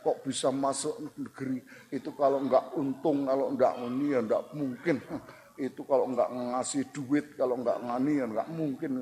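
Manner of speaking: hammed-down sour muttering; mock resentful. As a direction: grumble enviously to yourself, imitating a sore loser. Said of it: "kita malah sewot sendiri".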